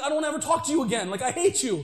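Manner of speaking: imitating angry outburst. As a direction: rattle it off as the hurt guy lashing out. Angry man rejecting preacher.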